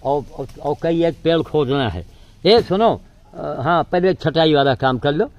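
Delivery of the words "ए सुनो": raised voice to call someone over; loud call across the yard. Real, not acted. (दूर से ज़ोर से पुकारना)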